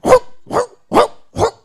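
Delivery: high, loud barked woof; dog barking. Acted. Preacher imitating a dog, loud barking. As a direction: bark like a dog.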